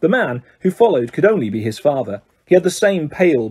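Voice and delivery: plain reading voice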